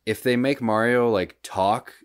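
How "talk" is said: ordinary delivery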